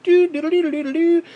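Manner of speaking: mock Miyamoto tootling on a pretend flute; mock flute tootle. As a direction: sing the notes very high and loud like a toy flute. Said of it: "acting like it's a stupid flute".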